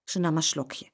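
usual speaking voice

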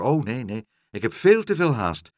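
doctor insisting on leaving; hurried refusal. A narrator's reading. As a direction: brush them off in haste. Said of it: "riep de dokter".

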